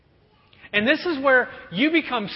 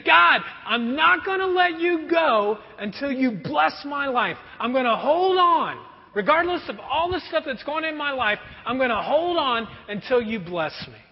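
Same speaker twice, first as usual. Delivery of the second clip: passionate plea; 5 semitones higher than usual. Acted. Jacob pleading with God, passionate cry. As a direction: hold on and beg with everything. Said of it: "Can you just hear the passion in Jacob's voice?".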